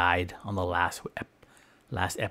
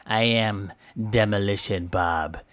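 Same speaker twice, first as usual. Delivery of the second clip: ordinary talk, nothing marked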